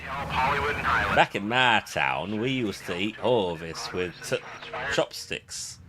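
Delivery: put-on a slow, drawn-out wise guy accent, lightly done; slow New York mobster accent, half-hearted; a hint of a wise guy accent, very slow. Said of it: "like, a wise guy accent".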